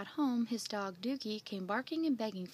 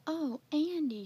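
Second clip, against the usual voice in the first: put-on high, louder girlish hail; calling out; 6 semitones higher, 6 dB louder, slower than usual. A narrator's reading. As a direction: call Andy over from a little way off. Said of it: "she called".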